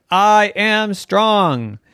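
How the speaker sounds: slow bold declaration. Acted. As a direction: say it slow and proud. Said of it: "Try it again".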